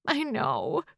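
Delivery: a touch of tearful acknowledgment; through tears. A narrator's reading. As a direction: say it as if through tears, lightly acted. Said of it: "I say through my tears".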